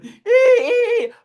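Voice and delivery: high squeaky honk noise; horn honk imitation. Driver honking the horn at the garage, high honk sound. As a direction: beep like a car horn.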